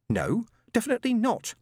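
high, testy refined voice; a haughty, curt refusal. Acted. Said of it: "was rather testy".

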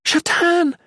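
very high, loud cry; desperate yell. A narrator's reading. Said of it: "the Shalane yelled".

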